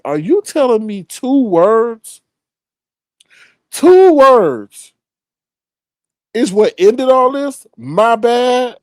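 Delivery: high, drawn-out disbelief; incredulous. Retold or imitated. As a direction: question it in rising outrage, hammed-up. Teller acts out his disbelief.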